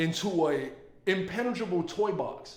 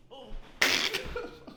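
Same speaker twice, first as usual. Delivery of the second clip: breathy whispered giggle; 13 semitones higher, 4 dB quieter than usual. Real, not acted. Whispered laugh after joke.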